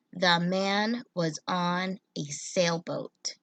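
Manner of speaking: put-on slow, clear sentence reading for children; slow read-aloud. Shown as a demonstration. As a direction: read it slowly for young readers. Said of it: "this sentence says".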